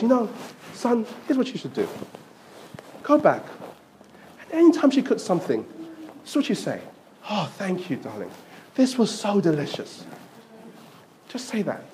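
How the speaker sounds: soft, hushed motherly counsel; wise mother's advice. Retold or imitated. Mother softly advises her son how to praise his wife. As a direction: quietly let him in on the secret. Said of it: "his mum listens to him and then says".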